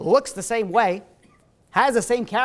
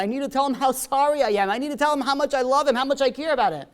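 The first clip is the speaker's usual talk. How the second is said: higher, building, urgent retold pleading; desperate plea; 6 semitones higher, 4 dB louder, faster than usual. Wife begs nurse to wake husband, acted desperation. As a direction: act out an insistent, emotional plea.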